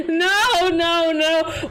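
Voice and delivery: high, laughing protest; laughing denial. Real, not acted. Streamer corrects chat about band kids, laughing protest. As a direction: say it through the tail of laughter.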